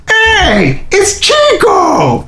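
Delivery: high, drawn-out shouted greeting; mock cheer. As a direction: call out the nickname like teasing bandmates. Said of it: "they're like".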